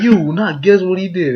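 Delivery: high, loud clickbait hype. Retold or imitated. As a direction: tease it like a clickbait video. Clickbait title voiced.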